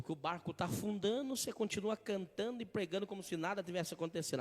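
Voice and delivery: ordinary talk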